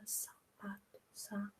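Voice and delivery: whispered syllable by syllable, slowly; barely audible whisper, working out the word; thinking to oneself. Acted. Child working out the word in their head, whispered thinking. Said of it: "vai pensar na cabecinha dela".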